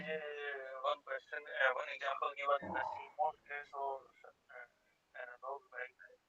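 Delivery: faint and distant on the line; very quiet. Real, not acted. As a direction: speak barely above a murmur. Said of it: "Sir, your voice is very low".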